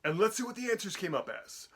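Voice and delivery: usual speaking voice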